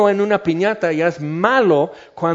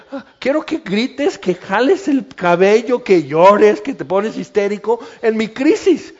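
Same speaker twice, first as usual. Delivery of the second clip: higher, louder mock-hysterical demand; high hammed-up plea; demanding drama; 5 semitones higher, 4 dB louder than usual. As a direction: ham up a needy person wanting a scene. Person wanting drama in crisis.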